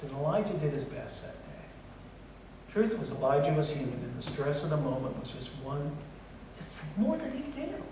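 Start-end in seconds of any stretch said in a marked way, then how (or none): none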